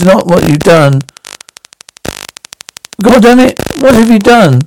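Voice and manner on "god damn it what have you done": high, shouted disbelief; horrified shout. Acted. Boss shouting at the shooter, horrified. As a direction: yell it in horror at what he did.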